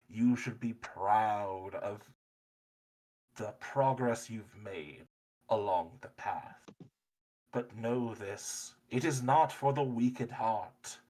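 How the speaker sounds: slow, ceremonial mysterious voice; reverberating voice. Acted. Tower's voice greets travelers who climbed down, slow and resonant. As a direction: stretch the words out like a voice filling a tower. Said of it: "a voice reverberates through the walls".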